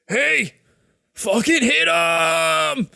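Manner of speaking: loud, drawn-out battle order; shouted command. Acted. Game master's knight rallies the dwarf to attack, shouted command. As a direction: call out sharply, then stretch the order.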